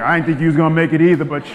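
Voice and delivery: playfully loud ribbing; teasing God. Retold or imitated. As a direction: laugh it off loudly. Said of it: "And God might be like".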